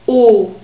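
isolated long German O; the O vowel alone, shown clearly. Shown as a demonstration. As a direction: hold a pure O with no glide. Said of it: "a long O sound".

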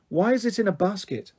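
ordinary delivery, nothing marked

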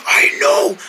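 high, strained rasping whisper; lost voice. Acted. King agreeing his voice is lost, strained whisper. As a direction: force it out without voice. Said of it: "you lost your voice".

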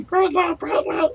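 very high squeaky gremlin voice; high-pitched creature squeak. Retold or imitated. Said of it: "I've always gone".

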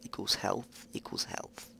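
whispered equals health, fading further; whispered to self. Real, not acted. Gamer working out stats, whispering to self. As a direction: mumble the sum to yourself. Muttering stat calculation.

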